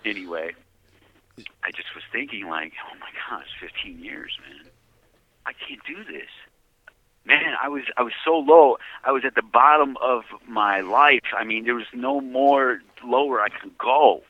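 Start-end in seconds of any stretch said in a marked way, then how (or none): 2.9-6.3 hushed despair. Retold or imitated. Retold despair in cell. 7.3-14.2 raised emotional. Real, not acted. Emotional peak of testimony.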